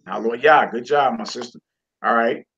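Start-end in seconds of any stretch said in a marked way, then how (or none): none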